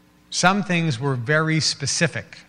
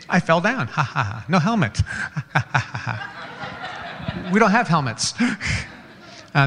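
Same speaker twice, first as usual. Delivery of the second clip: put-on laughing kid. Kid laughing after bike falls.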